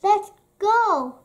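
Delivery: loud, high urgent plea; begging. A narrator's reading. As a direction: urge them to leave at once. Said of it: "begged Kay".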